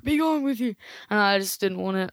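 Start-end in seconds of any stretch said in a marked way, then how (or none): 0.0-0.7 put-on higher-pitched old man dismissal; old man Scrooge. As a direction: shoo them off as an old miser. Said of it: "I'm an old man".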